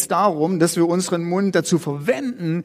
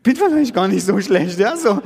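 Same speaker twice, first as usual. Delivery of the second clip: louder and higher, breaking into a laugh; 3 semitones higher, 5 dB louder than usual. Real, not acted. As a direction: chuckle through the relief. His own relieved thought retold.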